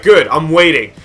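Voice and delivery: mildly heated, daring retort; retold defiant comeback. Worker daring angry caller before hanging up, retold defiant comeback. Retold shouting match on phone.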